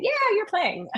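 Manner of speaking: very high encouraging singsong; humoring a child. Retold or imitated. Teacher answering little kid with ukulele, humoring. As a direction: cheer on a little one indulgently.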